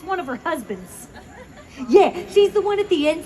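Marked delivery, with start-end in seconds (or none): none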